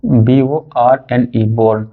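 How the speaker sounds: very slow letter-by-letter spelling; spelling out slowly. Shown as a demonstration. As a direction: dictate each letter so the class can write it. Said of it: "पहला है born". (धीरे-धीरे एक-एक अक्षर बोलना)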